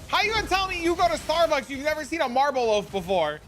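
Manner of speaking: high and loud, still in disbelief, easing down at the end; incredulous rant. Real, not acted. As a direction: keep the outrage up, let it settle by the last words. Repeats incredulous question, extended.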